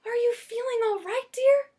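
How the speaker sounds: high, anxious motherly fussing; fussing Missus Prim. Acted. Missus Prim fretting over Lyle at the zoo, high and anxious.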